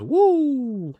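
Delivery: very high, loud whooping woo; Flair woo. Retold or imitated. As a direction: let out a big ringside woo. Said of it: "that's exactly what she says".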